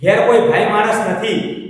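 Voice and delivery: ordinary delivery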